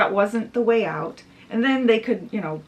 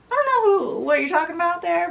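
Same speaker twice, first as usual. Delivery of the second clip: high, louder start, then a quick tumble; baffled dismissal; 8 semitones higher, 4 dB louder, faster than usual. Retold or imitated. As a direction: brush it off in high-pitched bafflement. Boy baffled by her claim.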